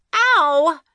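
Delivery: sharp high yelp of pain; cry of pain. A narrator's reading. Girl hit by swing yelps in pain. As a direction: yelp as the swing hits her head. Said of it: "she cried".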